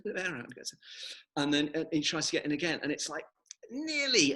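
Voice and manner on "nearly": high, loud squeezed "Nearly"; strained squeak. Acted. Baby balloon squeezing into the bed, strained squeak. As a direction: squeak it as if squashed tight.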